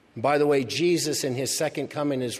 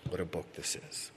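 dropped to a near hush, in awe; hushed repeat; 5 semitones lower, 11 dB quieter than usual. Shown as a demonstration. Pastor repeating to the congregation, hushed in awe. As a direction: repeat it softly, almost to himself.

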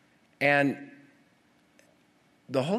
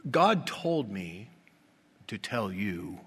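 mock spiritual voice; slower than usual. Mocking hyper-spiritual people.

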